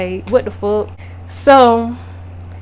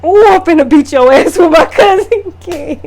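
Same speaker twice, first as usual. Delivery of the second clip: mock threat; 5 semitones higher, 5 dB louder than usual. Retelling her threat.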